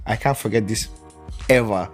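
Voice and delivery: ordinary talk, nothing marked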